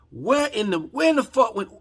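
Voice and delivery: furious, pitch jumping way up; pissed off. Real, not acted. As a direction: explode with real outrage. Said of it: "I'm pissed off. That's why I'm talking like this".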